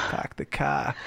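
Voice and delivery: slow, lazy Boston accent. Shown as a demonstration. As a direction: drop the r's like a Bostonian. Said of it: "the only thing I know how to say in a Boston accent".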